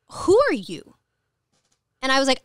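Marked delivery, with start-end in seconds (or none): 0.1-1.0 higher, louder incredulous ask; lightly voiced organizer, taken aback; organizer's reply. Organizer to a stranger at his event, a light surprised ask. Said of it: "And he goes".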